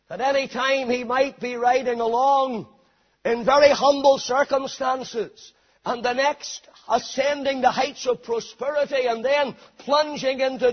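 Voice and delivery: ringing, rolling sermon delivery; full-force preaching. Real, not acted. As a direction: preach it with building momentum.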